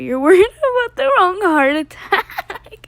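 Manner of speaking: can't hold it together while reading; laughing through the words. Real, not acted. Reading funny tweet, cracking up.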